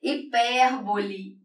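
"Hipérbole" stretched out slowly while writing; slow dictation. Shown as a demonstration. Teacher dictating the term slowly as she writes it. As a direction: drag out "Hipérbole" syllable by syllable. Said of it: "agora eu tô escrevendo hipermercado".

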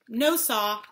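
ordinary delivery, nothing marked

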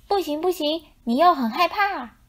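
high, firm little-lamb telling-off; a bossy lamb lecturing the kangaroo; bossy scolding. A narrator's reading. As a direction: scold the grown-up like a tiny boss. Child lamb scolding adult.

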